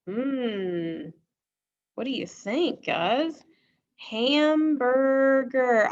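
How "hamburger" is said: Hamburger stretched very slowly, louder and higher; drawn-out emphasis. Shown as a demonstration. Teacher stretching Hamburger so students hear er twice. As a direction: stretch out Hamburger so both er sounds ring. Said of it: "I hear the sound er twice".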